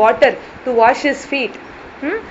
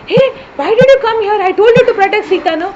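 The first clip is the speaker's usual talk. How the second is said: higher, louder and fast, reproaching; scolding shout; 8 semitones higher, 5 dB louder, much faster than usual. Acted. Rama scolding Lakshmana for leaving Sita, fast raised rebuke. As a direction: demand an answer, rushed and sharp. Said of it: "and Rama is shouting".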